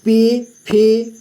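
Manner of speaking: पी फी said very slowly with a long held ee; long ee drawn out. Shown as a demonstration. Teacher reading the alphabet to students, long ee. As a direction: stretch the ee in पी and फी. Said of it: "ज़्यादा देर तक इसका उच्चारण करना है".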